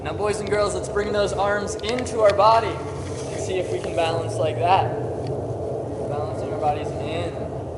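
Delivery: projecting to the whole class; loud teacher call. Real, not acted. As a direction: call it out across the gym. Calling out to the class.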